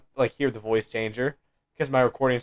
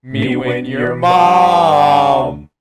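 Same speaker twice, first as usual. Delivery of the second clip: robotic auto-tuned singing, slow; auto-tune voice; 8 dB louder, much slower than usual. Pretend. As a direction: sing it through a pitch-correction effect. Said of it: "That was so auto-tune".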